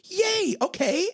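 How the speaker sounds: high squeal of glee; gallery owners' excitement. Retold or imitated. High gleeful squeal of the gallery people. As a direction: squeal it like thrilled hosts. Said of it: "And they're like".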